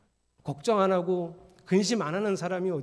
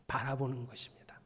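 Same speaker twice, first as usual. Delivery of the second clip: whispered; 5 semitones lower, 8 dB quieter than usual. Acted. Soft climax of the teaching.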